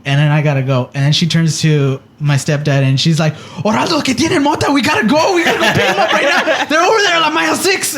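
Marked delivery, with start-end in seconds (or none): 3.6-7.9 much higher, frantic mother's voice breaking into laughter; panicked mom. Retold or imitated. Mom on the phone telling stepdad they must go, frantic and higher. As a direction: play the alarmed mom rushing to stepdad.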